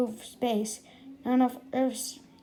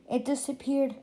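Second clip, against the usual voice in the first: plain reading voice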